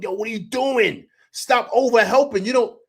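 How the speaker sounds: high, exasperated sideline holler; coach-style yelling. Retold or imitated. Fan playing coach at the TV, exasperated yell. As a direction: scold the defense, pitch jumping up. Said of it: "I'm screaming as if I'm the coach".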